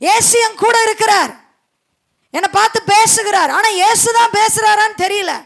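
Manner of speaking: high, ringing refrain with long pauses; forceful preaching. Real, not acted. Preacher lamenting the congregation's lack of discernment, forceful preaching. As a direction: drive each line home like a revival preacher.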